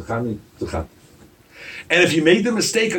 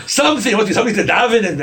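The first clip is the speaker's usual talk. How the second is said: exasperated demand; 6 semitones higher, 6 dB louder than usual. Acted. Demands Eli say something.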